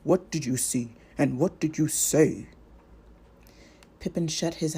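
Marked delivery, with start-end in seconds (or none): 0.0-2.5 stern. Acted. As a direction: cut him off sternly. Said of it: "said Gandalf sternly".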